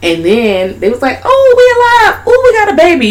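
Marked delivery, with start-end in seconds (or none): 1.2-3.1 very high gleeful discovery; high delighted doll voice. Retold or imitated. Friend retelling the movie, the dolls finding they have a baby, high glee. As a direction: squeal it up high with joy.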